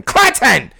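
another mock holler of "Clinton!"; mom calling his name. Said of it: "That's how she calls my name".

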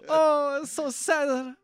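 very high, loud mock Italian grandma wail; Italian accent. Playing the crying Italian grandma in mock accent. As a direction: ham up a weepy Italian accent, laughing. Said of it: "enough to do an Italian accent".

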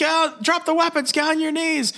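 high, strained cop command; mock police shout. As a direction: imitate a frantic officer giving orders. Cops shouting arrest orders.

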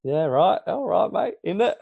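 high, playful British slang impression; mock British accent. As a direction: ham up British matey slang, pitched high. Mocking picked-up British slang.

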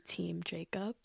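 Team Jacob whispered very softly; sheepish whispered Team Jacob. Real, not acted. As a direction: breathe Team Jacob like a guilty secret. Apologetic whispered aside.

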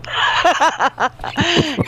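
short burst of real laughter; laughing. Real, not acted. Laughs at joke.

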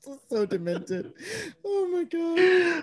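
slow, high gasp through laughing; helpless laughter. Real, not acted. Reacting to a wild show pitch, helplessly laughing. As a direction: wail it while cracking up.

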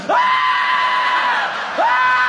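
shrill, loud panic scream; terrified scream. Retold or imitated. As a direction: scream like the jet is falling. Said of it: "in the back seat going".

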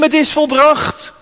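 high, loud cry of victory. Acted. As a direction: call it out like a triumphant shout. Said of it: "met luider stem".

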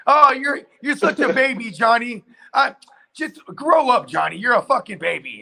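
mimicking a condescending wife; mocking her. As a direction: sneer like a scornful wife. Mocking Amber voice to Johnny.

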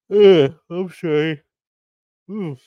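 words stretched through a yawn. Real, not acted. Vlogger yawning while apologising to viewers. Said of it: "yawning's fun".

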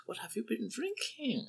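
very high breathy whisper, drinking falling; sparkling interest. A narrator's reading. Old man whispering his question to the drunk. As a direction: whisper it with twinkling curiosity. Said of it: "his eyes sparkling with interest".

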